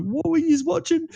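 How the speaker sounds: very high mock mum voice, worried; imitating a mum. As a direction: squeak it out like a fussing mum. Friend's mum voiced in story.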